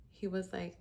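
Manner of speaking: normal talking voice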